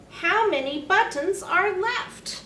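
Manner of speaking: usual speaking voice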